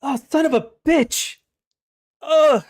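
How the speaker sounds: loud, high angry curse; pained outburst. Acted. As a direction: spit out the curse in pain. Freed captive cries out.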